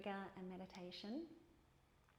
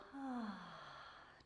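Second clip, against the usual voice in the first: long audible sigh out; demonstrated exhale; 4 dB quieter than usual. Shown as a demonstration. Teacher showing the class how to exhale, audible sigh. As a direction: let the breath fall out in one long sigh. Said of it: "And as you exhale".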